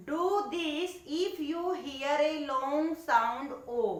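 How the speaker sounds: slow, deliberate reading of the English line; slow read-out instruction. Shown as a demonstration. Teacher slowly reading the task to young pupils, deliberate. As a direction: read each word slowly to the class. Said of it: "teacher reads instruction aloud".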